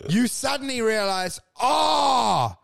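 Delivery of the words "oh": loud, high, long "oh"; dawning realisation. Retold or imitated. Wedding guest suddenly realising he has no friends, dawning realisation. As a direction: act out the penny dropping.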